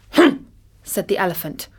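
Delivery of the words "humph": disdainful humph. A narrator's reading. Elephant grunts in disdain.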